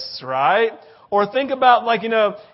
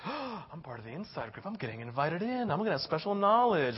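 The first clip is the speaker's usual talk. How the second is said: much softer, awed insider voice; hushed thrill; 2 semitones lower, 8 dB quieter than usual. Acted. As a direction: savour the secret under the breath.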